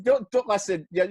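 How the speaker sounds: ordinary talk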